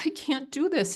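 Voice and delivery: retold crying. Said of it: "And I'm crying".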